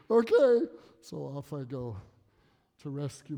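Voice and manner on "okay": a sharp, loud "Okay."; curt loud okay. Retold or imitated. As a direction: bark a clipped okay as the wife.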